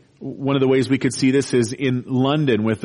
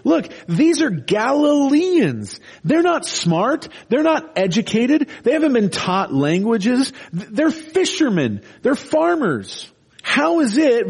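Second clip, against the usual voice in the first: incredulous crowd; 9 semitones higher than usual. Retold or imitated. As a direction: scoff at fishermen speaking languages.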